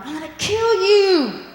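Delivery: imagined vengeful Joseph, mock angry; mock threat. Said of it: "You would think he would have been like".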